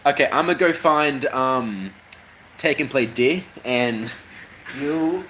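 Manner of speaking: ordinary talk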